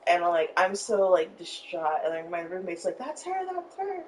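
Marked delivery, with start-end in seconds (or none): 3.0-4.0 high-pitched, excited, a little softer; excited high squeal. Retold or imitated. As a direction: squeal it with joy. Roommate quoted excitedly.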